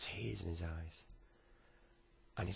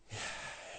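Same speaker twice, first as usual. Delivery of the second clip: long breathy exhale; heavy sigh. Acted. Boy about to tell granddad, heavy sigh. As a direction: sigh before confessing.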